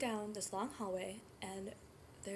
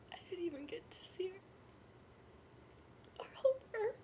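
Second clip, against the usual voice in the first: higher, voice breaking into crying; tearful; 7 semitones higher, 5 dB quieter than usual. Acted. Birth mother tells Emily she never held her baby, tearful. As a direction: fight tears and let the voice crack.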